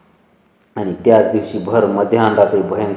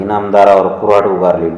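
ordinary delivery

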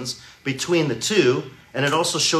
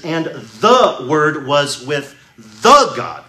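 "with the God" spelled out slowly, each word weighted; slow literal reading; 4 dB louder, much slower than usual. Shown as a demonstration. Preacher rendering the Greek literally, slow and deliberate. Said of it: "it literally says".

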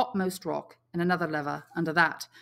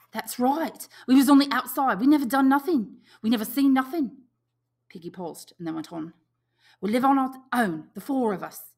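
high and louder, eager; eager boy; 7 semitones higher, 7 dB louder than usual. Acted. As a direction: agree eagerly. Piggy voiced high, eager.

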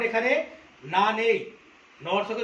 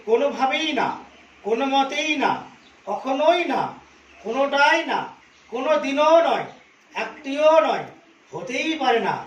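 each word hit hard, কোনোভাবেই, মতেই, কখনোই, কোনোটাই, দিনও, একটিও punched louder with a falling end; stress on every word; 2 semitones higher, 3 dB louder than usual. Shown as a demonstration. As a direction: stamp each negative word, one by one, falling firmly. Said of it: "প্রত্যেকটি শব্দে জোর দেওয়া হয়েছে". (প্রতিটি শব্দে জোর দিয়ে, কোনোভাবেই কখনোই একটিও জোরে ও নামিয়ে)

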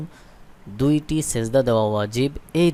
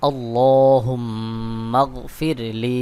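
আল্লাহুম্মাগফির লি drawn out slowly, every syllable long; slow joined recitation; much slower than usual. Shown as a demonstration. Teacher to viewers, joining the spelled word very slowly. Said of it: "দোয়াটি বানান করে পড়ব".

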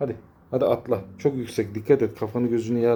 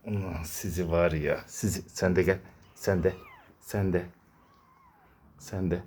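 softer, lower murmur; soft coo; 5 semitones lower, 7 dB quieter than usual. Real, not acted. Talking to kittens beside their resting mother, hushed. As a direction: hush your voice over sleeping kittens.

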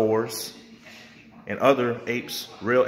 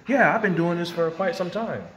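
mocking quote; 7 semitones higher than usual. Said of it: "He's fooling all of them".

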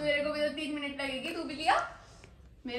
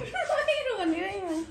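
laughing denial; 3 semitones higher, much slower than usual. Real, not acted. Denies crying while laughing.

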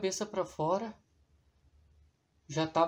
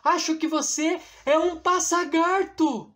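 curious character voice; 9 semitones higher, 4 dB louder than usual. Acted. Wilson guessing about strange creature.

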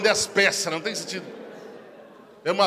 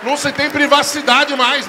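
high, loud, slow complaint; indignant outburst; 7 semitones higher, 10 dB louder, slower than usual. Retold or imitated. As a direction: belt out the grievance, stretching every word. Reclamação indignada sobre o vídeo. (reclamação indignada, alta, aguda e arrastada)

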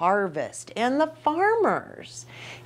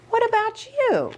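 ordinary delivery, nothing marked

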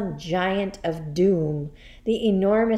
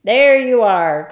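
triumphant cry; 3 semitones higher, 13 dB louder than usual. Acted. Headmistress catches the teacher out, crying in triumph. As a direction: crow at being proved right. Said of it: "cried the Trunchbull, triumphant".

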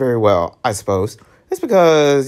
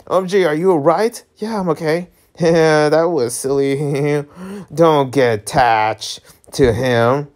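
ordinary talk

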